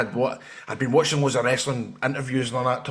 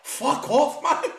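high mock-angry whine; exasperated, pleading brush-off pitched high; hungover refusal; 13 semitones higher, much slower than usual. Hungover man refusing his family, high exasperated protest.

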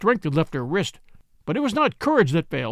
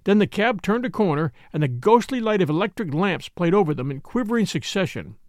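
usual speaking voice

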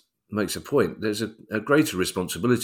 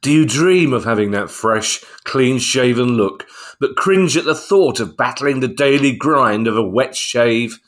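louder, upbeat advert voice; ad read; 4 semitones higher, 6 dB louder than usual. Acted. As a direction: sell it like a shaving commercial. Advert read over music.

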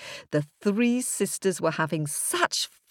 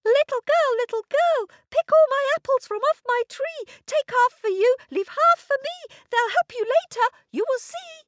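very high, loud squeaky tree voice; talking apple tree; 6 dB louder, faster than usual. A narrator's reading. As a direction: chant it loud and piercingly high. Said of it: "said the apple tree".

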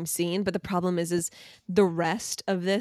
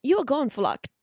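clumsy attempt at an English accent, higher pitched; hammed-up English accent, pitched up; bad English accent; 6 semitones higher than usual. Shown as a demonstration. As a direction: try an English accent and do it badly. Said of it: "I gotta do this in English accent".

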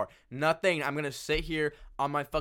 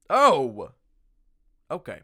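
ordinary talk

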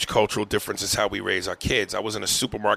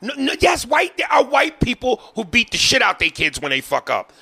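much higher and louder, yelling the point; heated shout; 13 semitones higher, 5 dB louder than usual. Real, not acted. Heated race argument.

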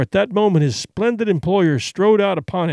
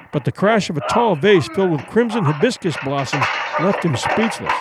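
ordinary delivery, nothing marked

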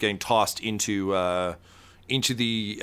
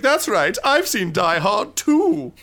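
laughing movie villain brag; villain taunt; 10 semitones higher, 6 dB louder than usual. Acted. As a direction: gloat over the wounded hero. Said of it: "and he's like".

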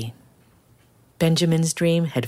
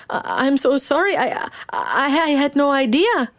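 put-on higher, stuttering frightened boy; higher, nervous stammer; stammering apology; 12 semitones higher than usual. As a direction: stumble over the apology to the king. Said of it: "stammered Benjamin".

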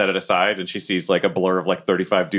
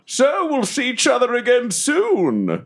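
high, drawn-out swooping voice; hammed-up suitor's goodbye; theatrical farewell; slower than usual. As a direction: sign off grandly, stretching the words. [name] says goodbye after date.

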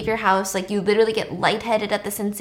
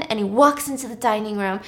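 plain reading voice

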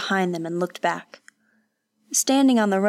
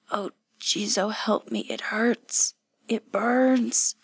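pained whimper; 3 semitones higher, slower than usual. A narrator's reading. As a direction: whimper weakly for help. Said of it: "Hatsumi whimpered".

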